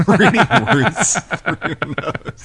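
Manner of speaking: laughing through the words, slow and high. Real, not acted. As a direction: crack up while repeating the line. Repeats joke while laughing.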